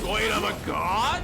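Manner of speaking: mock amazement. Mimics the boy's amazed reaction.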